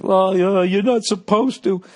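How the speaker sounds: high, prissy, loud mock of himself; mock your own lame dodge; smug weaselly comeback. Comic mocking how he answered the heckler, high and prissy. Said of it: "Instead, I just said".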